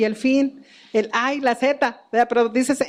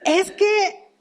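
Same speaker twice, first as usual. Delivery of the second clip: lightly voiced upset little boy, higher; a mother mimicking her child's hurt complaint, a touch higher and lighter; mother imitating her young son's complaint, mildly childlike; 5 semitones higher than usual. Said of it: "Y él decía".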